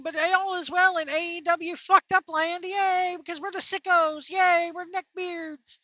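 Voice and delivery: mock the gleeful fans with a sneer; sarcastic mock of cheering sicko fans.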